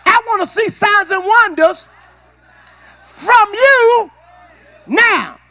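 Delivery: loud, high preacher's cry to the neighbor; shouted call-and-response. Shown as a demonstration. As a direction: belt it out to the person beside you. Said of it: "look at your neighbor and say".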